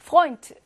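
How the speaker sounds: "Freund" said loud with "eu" as oi; German diphthong demo. Shown as a demonstration. Teacher shows the eu sound in "Freund" to students. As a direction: hit "Freund" hard, eu sounding like oi. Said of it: "요거 뭘로 발음해".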